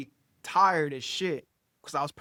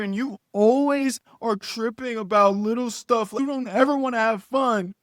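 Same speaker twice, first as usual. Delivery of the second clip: loud, higher imitation of a whining girlfriend; hammed-up aggrieved complaining, pitched up; mock indignant complaint; 5 semitones higher, 5 dB louder than usual. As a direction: mimic her worked-up protest. Mimicking girlfriend's angry complaint.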